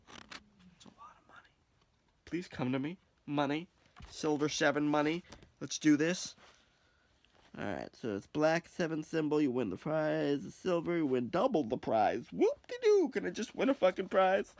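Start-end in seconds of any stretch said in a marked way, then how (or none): 0.1-1.5 whisper. Real, not acted. Repeat whispered longingly. 12.3-13.1 high sarcastic whoop-de-doo; mock cheer, full of sarcasm. Real, not acted. Scoffing at the double prize, high and sarcastic.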